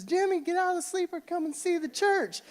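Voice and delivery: a father frantic at losing his son, hammed-up shout; hammed-up excited dad. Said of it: "and I says".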